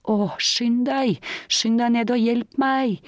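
high, drawn-out plea for help; pleading gasp. A narrator's reading. Injured cyclist begs her friend to hurry down, mildly distressed plea. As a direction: beg for help, a touch distressed. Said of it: "gisper hun".

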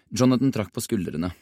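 plain reading voice

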